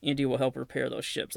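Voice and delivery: ordinary talk, nothing marked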